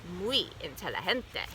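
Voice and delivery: plain reading voice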